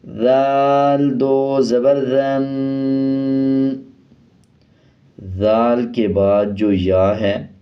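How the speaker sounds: slow, sing-song Qaida spelling chant; slow recitation drill. Shown as a demonstration. Teacher leads children through the Noorani Qaida drill, slow and sing-song. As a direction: chant each syllable slowly for students to repeat.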